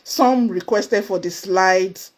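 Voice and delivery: ordinary delivery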